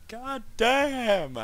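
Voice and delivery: high, loud God damn; laughing frustration at the game; exasperated yell. Real, not acted. Character dies in game.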